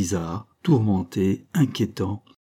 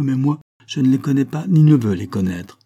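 louder, higher flat-out refusal; defiant; 5 semitones higher, 6 dB louder than usual. A narrator's reading. Daughter refusing to know her mother's friends, defiant. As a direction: push back firmly, raising the voice.

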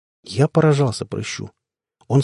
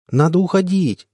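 higher, pleading plea; almost whining to leave; plaintive; 6 semitones higher than usual. Acted. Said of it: "сказал я почти жалобно".

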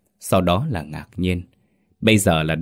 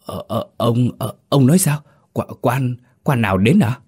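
higher, stuttering panic; stammering in fright; 5 semitones higher, 3 dB louder than usual. Acted. Terrified servant stammering at his master, higher. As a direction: stumble over every word, scared stiff. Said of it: "Nó ú ớ".

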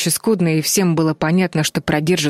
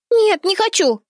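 very high, whiny little voice; child's whimper; slower than usual. A narrator's reading. Чарли refuses to jump from the tower, whimpering. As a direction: whine a refusal like a scared little girl. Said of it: "захныкала Чарли".

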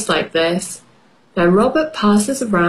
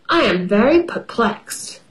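ordinary delivery

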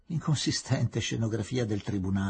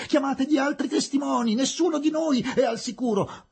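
very high, loud, sustained cry; shouting; 14 semitones higher, 4 dB louder than usual. A narrator's reading. Missus calling for other witnesses in the tumult, shouting. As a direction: cry out the alarm, pitch held high throughout. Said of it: "gridò missus Tarton nel tumulto crescente".

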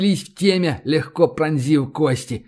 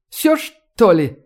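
slow, higher-pitched uncertain question; bewildered; 5 semitones higher, much slower than usual. A narrator's reading. Professor staring into the smoke, slow bewildered question. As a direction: ask it hesitantly, at a loss. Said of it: "растерянно спросил Вессенберг".

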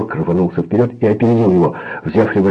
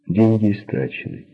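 plain reading voice

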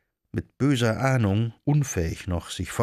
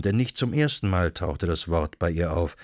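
ordinary delivery

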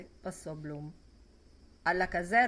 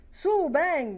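acclamazione piena e più alta; grido; 9 semitones higher, 8 dB louder than usual. A narrator's reading. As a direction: chiamalo forte dal bancone. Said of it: "gridò Simon Dedalus". (Simon incita Ben, grido acuto e forte)

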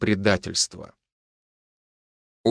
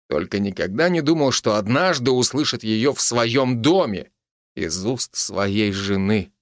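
ordinary delivery, nothing marked

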